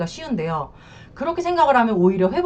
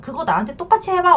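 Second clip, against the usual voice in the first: higher-pitched insistent challenge; acted demand; 7 semitones higher, 4 dB louder than usual. Wife demanding the mistress's treatment from husband, acted demand. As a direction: insist on it, voicing a resentful wife.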